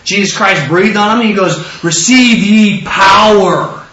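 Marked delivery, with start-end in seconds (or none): 1.8-3.8 slow, drawn-out proclamation; solemn command. Retold or imitated. Jesus to his disciples, solemn drawn-out command. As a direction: stretch it out with weight and force.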